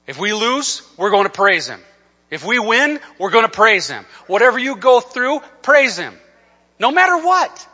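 high, loud rallying quote; rousing preaching. Retold or imitated. Preaches the coach's rallying line.

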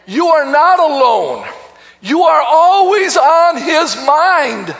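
high, loud, rousing pulpit proclamation; preaching at full force. Real, not acted. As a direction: build to a shouted call to the congregation.

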